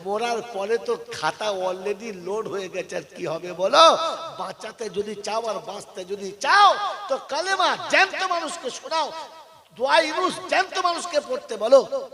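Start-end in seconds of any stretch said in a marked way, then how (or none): 3.5-12.1 high, loud preaching with a laugh in it; rally the crowd, half laughing; fervent sermon with laughter. Acted.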